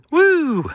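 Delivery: high, loud rock-star woo. Retold or imitated. After his rock parody, a high whoop.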